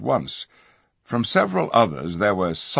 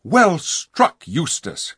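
cried out; 10 semitones higher, 4 dB louder, slower than usual. A narrator's reading. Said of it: "cried Tyrion".

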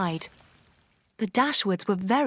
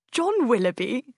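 happy cry; 3 semitones higher, 4 dB louder than usual. A narrator's reading. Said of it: "Sir John cried, laughing happily".

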